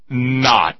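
"Not" punched hard and loud; stress on NOT. Shown as a demonstration. Listener's sarcastic note, read with "Not" hit hard. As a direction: slam down a single "Not". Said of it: "Emphasis on the word not".

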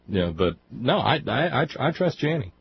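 normal talking voice

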